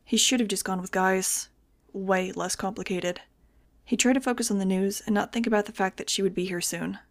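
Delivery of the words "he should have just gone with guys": plain reading voice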